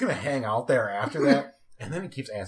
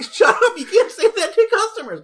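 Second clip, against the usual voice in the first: much higher, loud, laughing rebuke; 12 dB louder than usual. Retold or imitated. Telling coworker off about customers, laughing high. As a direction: scold him while barely holding it together. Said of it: "I'm like".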